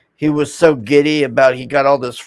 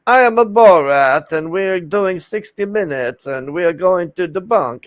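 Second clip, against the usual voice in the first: mock Borat accent, higher and put-on; 4 semitones higher than usual. Mocking the show as Borat announcing a debunk, put-on accent. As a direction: ham up a Borat-style announcement. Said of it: "it's like".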